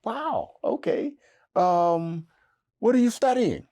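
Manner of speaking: high, loud, starstruck stammer; a nervous young man thrilled she sat down; flustered excitement. Retold or imitated. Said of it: "And so I'm thinking".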